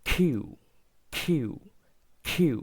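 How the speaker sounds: কিউ repeated with a gliding "yoo"; কিউ stressed and drawn out; letter Q. Shown as a demonstration. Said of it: "তারপর".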